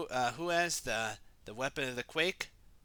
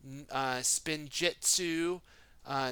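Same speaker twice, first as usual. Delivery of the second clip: ordinary delivery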